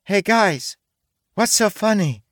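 put-on high-pitched girlish call. A narrator's reading. Titha calls to her laughing friends, girlish. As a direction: call over brightly from a short distance. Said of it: "a girl's voice called out".

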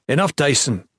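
curt command to stop; interrupting. A narrator's reading. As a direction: cut the speaker off firmly. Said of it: "Jen interrupted".

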